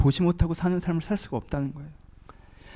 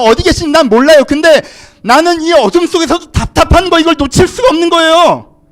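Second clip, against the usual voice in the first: high, shouted preaching at fever pitch; full-force preaching; 11 semitones higher, 12 dB louder than usual. Real, not acted. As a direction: shout the sermon out with mounting urgency. (목청껏 부르짖는 설교)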